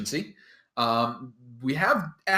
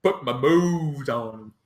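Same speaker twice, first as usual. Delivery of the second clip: bragging ogre. Acted. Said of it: "bragging to his friend".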